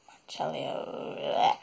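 Monticello whispered slowly under the breath; whispered to herself. Shown as a demonstration. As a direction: Monticello muttered slowly, almost silent. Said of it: "One of you all can correct me on that".

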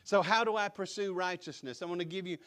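loud, rousing pulpit preaching; Southern Baptist preacher. Real, not acted. Pastor rousing the congregation with booming Southern Baptist preaching. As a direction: preach it out full force like a revival preacher. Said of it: "I turned into a Southern Baptist preacher".

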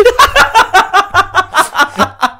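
high-pitched cackle; long, high burst of laughing; real laughter. Real, not acted. Podcaster cracking up at the cocky contestant story, laughing.

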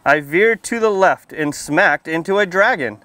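put-on lively storybook read-aloud for little kids; reading to children. A narrator's reading. Firefighter reading a picture book to kids at story time, lively read-aloud. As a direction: read it out bright and clear for the children.

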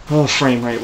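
usual speaking voice

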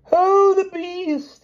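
very high, loud, long-drawn scoff; reckless cry. A narrator's reading. King dismisses the danger, crying recklessly. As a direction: cry it out with giddy abandon. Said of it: "he cried recklessly".

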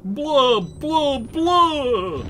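high, loud vampire "blah"; hammed-up Dracula-style bleating; mean vampire. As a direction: cry out like a comic vampire. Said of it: "I can also do a mean vampire".

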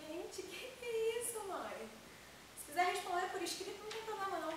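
high-pitched feigned shock; cheeky, high-voiced protest; mock innocence. Daughter teases threatening mother.

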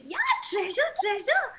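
high, squeaky thrilled duckling; duckling thrilled about the treasure; excited. A narrator's reading. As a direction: bounce with excitement over treasure. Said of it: "Ducky is very excited".

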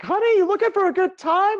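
high, loud put-on come-on; imitated high-pitched hustler; street solicitor. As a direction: call out a proposition on the street. Street people propositioning him.

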